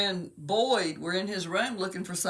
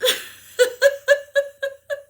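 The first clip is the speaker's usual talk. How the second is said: high, loud helpless laughter; squealing laugh after the punchline; 7 dB louder than usual. Real, not acted. Mother amused by her own wailing impression, laughing.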